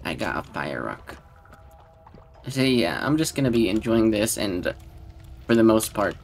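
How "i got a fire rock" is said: flat mock joy. Real, not acted. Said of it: "Today's my lucky day".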